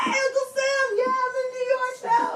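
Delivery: high-pitched, eager mock hello; excited greeting. Greeting Uncle Sam on moving in, excited and high.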